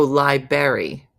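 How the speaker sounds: loud, low "lie-berry" for library; mispronounced library. Shown as a demonstration. Repeating the mispronunciation just read.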